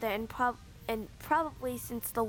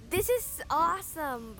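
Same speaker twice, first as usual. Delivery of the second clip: higher, drawn-out delight; excited cry; 4 semitones higher, slower than usual. A narrator's reading. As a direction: stretch it out with glee. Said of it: "Boulder cried".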